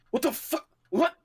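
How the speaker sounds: high-pitched, startled outburst; startled pilot losing control; acted alarm. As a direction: blurt it in disbelief. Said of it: "like, McGillish, like".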